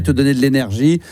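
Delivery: ordinary talk, nothing marked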